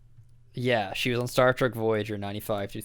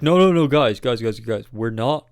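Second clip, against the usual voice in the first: loud, emphatic mock objection; retold protest; 6 dB louder than usual. Filmmakers answering the physicists' complaints, imitated insistent protest. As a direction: cut in insistently, louder at the start. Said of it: "and they were like".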